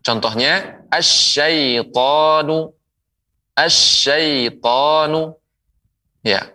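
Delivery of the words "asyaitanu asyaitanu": slow "asyaitanu", held sh and long a; doubled sh. Shown as a demonstration. Quran teacher models the letter shin, doubled sh. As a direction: draw out "Asyaitanu", lingering on the sh. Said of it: "berikutnya adalah huruf shin".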